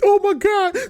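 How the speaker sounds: overwhelmed oh my God. Retold or imitated. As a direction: blurt it out, overcome in the cinema. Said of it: "I was like".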